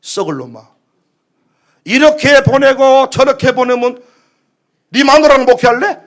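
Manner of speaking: loud, rebuking outburst; scolding Holy Spirit. Acted. Holy Spirit slaps the pastor's back, loud scolding. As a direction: tell off a stubborn pastor. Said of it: "등짝을 내리치면서".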